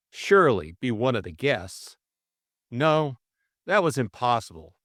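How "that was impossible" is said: ordinary delivery, nothing marked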